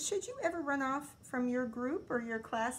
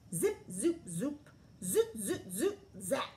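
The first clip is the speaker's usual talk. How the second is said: playful sound effects; 3 semitones higher than usual. A narrator's reading. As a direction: pop out each sound.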